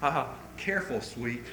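very soft, low, tender murmur; imitated lover's whisper. Lover as his sweetheart trips, imitated whisper. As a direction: hush it like a doting sweetheart. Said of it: "he whispers".

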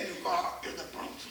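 low, soft, half-whispered affected reply; mock Bronx put-on. Hometown boy back from New York, boasting in a put-on whisper. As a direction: show off the new big-city airs.

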